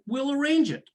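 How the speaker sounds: high, compliant repeat of someone's order; obedient echo. Retold or imitated. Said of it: "And I said".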